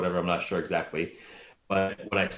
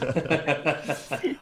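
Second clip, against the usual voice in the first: laugh; 8 semitones higher than usual. Real, not acted. Group laughter over accent joke.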